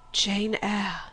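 hushed-back astonished name; restrained amazement; stunned recognition. A narrator's reading. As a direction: say the name in disbelief, held in. Stunned recognition, name repeated louder.

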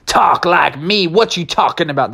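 puzzled retort in a western drawl; unusual western drawl. Acted. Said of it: "You're talking so unusual".

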